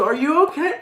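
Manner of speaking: a lightly played worried friend; mock concerned friend. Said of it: "if someone's coming at you".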